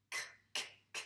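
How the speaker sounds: bare voiceless "k" clicks with pauses between; isolated k sound. Shown as a demonstration. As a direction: repeat "k" crisply, with no vowel after it. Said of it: "le son". (le son « k » isolé, répété sans voyelle)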